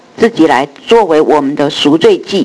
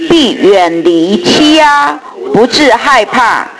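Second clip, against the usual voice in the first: slow solemn group recitation; scripture reading; 3 semitones higher, slower than usual. Real, not acted. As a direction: read the verses slowly, with reverence. Congregation reads scripture aloud. (慢速庄重读经)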